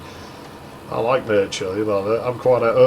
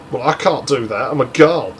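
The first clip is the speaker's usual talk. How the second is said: mock whine climbing higher and louder; retold annoyed whining; 4 semitones higher, 3 dB louder than usual. Girl fed up with the countryside, hammed-up annoyed whine. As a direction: build an imitated gripe to a peevish peak. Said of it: "I was proper annoyed about it".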